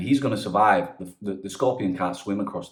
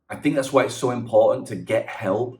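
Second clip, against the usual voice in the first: ordinary delivery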